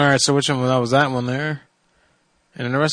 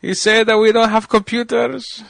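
higher, slow mocking Iranian accent; 8 semitones higher, slower than usual. Retelling the hotel clerk's words in a mock Iranian accent. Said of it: "He was like".

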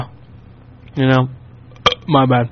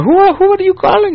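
caller impression. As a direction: mimic the caller's squeaky question.

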